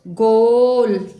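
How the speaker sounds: ज़ोर से, धीमे खींचकर "गोल"; गोल को लंबा खींचो, ऊँचे स्वर में. Shown as a demonstration. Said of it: "आगे देखें". (अक्षर जोड़कर पढ़ाते हुए ज़ोरदार)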